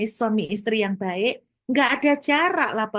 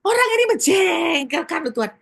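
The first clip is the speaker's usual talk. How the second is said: higher, louder shouted complaint; exasperated shout at God; 8 semitones higher, 5 dB louder than usual. Retold or imitated. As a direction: yell out your annoyance in prayer. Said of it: "saya terbuka sekali, saya jujur".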